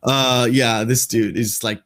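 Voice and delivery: usual speaking voice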